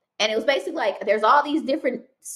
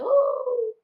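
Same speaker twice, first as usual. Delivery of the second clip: very high, louder ooh; amazed ooh; 4 dB louder than usual. Retold or imitated. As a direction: struck by the revelation. Realizing she runs a business.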